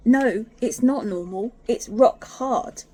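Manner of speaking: loud, slow firm protest; mock indignant, loud and slow; retold insistence. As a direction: insist slowly and loudly. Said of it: "I went".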